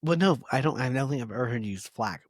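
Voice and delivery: ordinary talk, nothing marked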